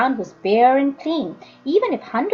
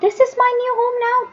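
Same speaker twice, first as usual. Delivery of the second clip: put-on boy's bitter thought; 12 semitones higher than usual. Jim's bitter thought voiced.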